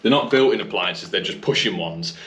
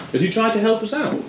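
surprised; 13 semitones higher than usual. Real, not acted. Surprised discovery of builder's help.

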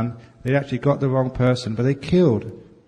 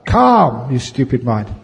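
mock scolding; 5 dB louder, slower than usual. Shown as a demonstration. Scolding his own restless mind, mock angry. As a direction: scold the mind like a stubborn child.

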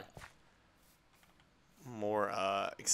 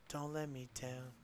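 quiet plea; 7 dB quieter than usual. Real, not acted.

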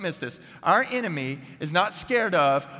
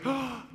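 sharp gasp before a roar; big preparatory gasp; 8 semitones higher, 5 dB quieter than usual. Acted. Cub gathering himself to roar again, big gasp. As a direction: suck in air to gather yourself. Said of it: "gathers himself again and he goes".

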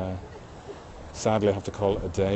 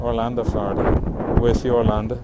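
loud, cheering shout-outs; hearty greeting; 8 dB louder than usual. Real, not acted. Livestreamer toasting Venezuela and Orlando viewers, loud cheer. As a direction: cheer each city like a toast.

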